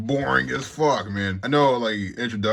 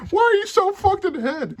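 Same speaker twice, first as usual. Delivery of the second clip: mock outraged question; 4 dB louder than usual. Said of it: "If anyone was".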